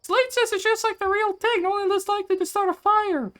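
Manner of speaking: very high put-on little voice; tiny character voice. Streamer imitating how others voice the small character, high little voice. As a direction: squeak it out like someone two feet tall. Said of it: "like, two feet tall".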